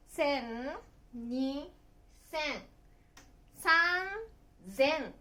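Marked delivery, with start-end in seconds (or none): 3.6-5.1 三千 read "san... zen", voiced z; sanzen. Shown as a demonstration. Teacher counting thousands for learners, san-zen with a z. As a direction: stretch 三, then say 千 as "zen". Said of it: "と読みます".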